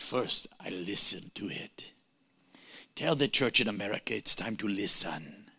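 slow, deep imitation of Mike; impression of Mike. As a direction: speak low and weighty as the old pastor. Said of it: "I'm not gonna do voice impersonations".

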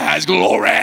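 GLORY roared as glo-RAY in preacher accent. Shown as a demonstration. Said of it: "use a preacher accent".

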